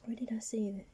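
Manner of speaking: hushed whisper while searching; whispering to self. Real, not acted. Vlogger hunting for the copyright date, whispering to self. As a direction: whisper the question to yourself. Searching the booklet, talking to herself.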